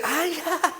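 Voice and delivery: high, breathy little giggle in imitation; imitated giggle. Preacher imitating the elder laughing with the sister, high giggle. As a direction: mimic the elder's silly laugh. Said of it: "en cada que se reía".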